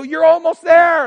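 loud, high cheer from above; encouraging shout. Retold or imitated. Hikers at the top shouting encouragement down to the pastor, loud and high. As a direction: shout hope down the mountain. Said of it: "They go".

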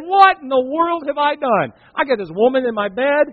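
a lightly voiced King David crying out; distraught David. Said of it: "He woke up one day and said".

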